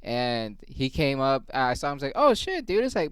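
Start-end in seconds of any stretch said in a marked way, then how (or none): 2.1-2.8 startled high yelp of surprise; surprised. Retold or imitated. Spots the late coworker, startled high gasp. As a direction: suddenly caught off guard.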